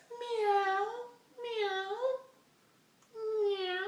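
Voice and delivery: very high, slow crying meow; cat meow. Retold or imitated. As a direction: mewl like a hungry cat. Cat meowing imitation.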